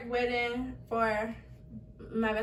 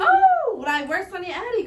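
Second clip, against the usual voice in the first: much higher, louder sing-song greeting; high fake-cheerful question; 8 semitones higher, 9 dB louder than usual. Daughter walking into dorm room, high fake-cheerful question. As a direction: greet them overly brightly. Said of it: "So I'm like".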